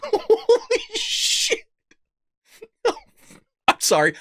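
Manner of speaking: slow, high, laughed-out words; wheezy high laugh while speaking; laughing disbelief. Real, not acted. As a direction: stretch it out through helpless laughter. Helpless laughter at the news.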